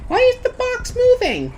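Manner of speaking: high-pitched amused bewilderment; tickled, laughing. Real, not acted. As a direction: squeal the question between laughs. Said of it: "I'm more or less just laughing".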